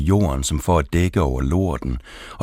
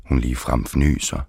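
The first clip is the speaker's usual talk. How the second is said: usual speaking voice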